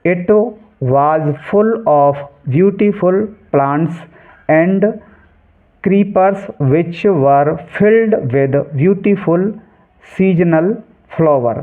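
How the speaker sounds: careful, drawn-out textbook reading; slow reading aloud. Shown as a demonstration. Teacher reading the English passage to students, slow and clear. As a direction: read each English word very slowly and clearly.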